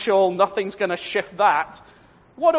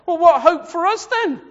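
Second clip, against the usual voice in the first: despairing question; 10 semitones higher, 7 dB louder than usual. Retold or imitated. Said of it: "we could go into Psalm two and say".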